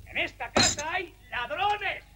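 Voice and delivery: high, slow, drawn-out distant cry; alarmed cry. Acted. Don Ventura alerting the boarding house, high outraged cry. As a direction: announce the theft to the whole house, high-pitched. Crying out about a theft.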